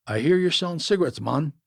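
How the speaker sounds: narrator's lightly voiced Jamaican accent, pushing the question like a demand; thick Jamaican accent. A narrator's reading. Stranger approaching a van about cigarettes, demanding Jamaican accent. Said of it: "one said in a thick Jamaican accent".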